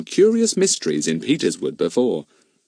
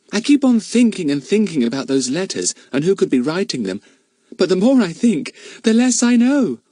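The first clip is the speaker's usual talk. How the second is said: put-on Daisy, dreamy girl; 8 semitones higher than usual. Daisy to the others, high and lazy. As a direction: drift off in the warm sun.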